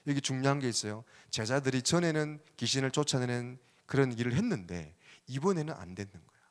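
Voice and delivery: usual speaking voice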